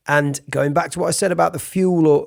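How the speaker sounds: ordinary talk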